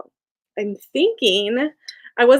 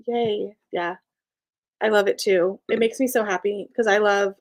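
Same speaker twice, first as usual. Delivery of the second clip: ordinary talk, nothing marked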